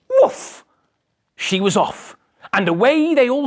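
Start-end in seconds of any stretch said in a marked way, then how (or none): 0.1-0.6 dog bark. Acted. Dog barks in story.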